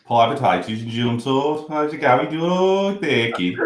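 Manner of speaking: put-on Birmingham accent. Shown as a demonstration. Co-host asked to do his best Birmingham accent, Birmingham accent. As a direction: do your best Brummie. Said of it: "Do your best Birmingham accent".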